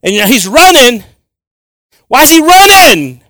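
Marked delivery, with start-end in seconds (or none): 2.1-3.2 high, loud and slow, almost shouting; amazed outcry. Real, not acted. Preacher to congregation, marvelling at a running tax man, loud amazed outcry. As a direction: throw the question out in astonishment.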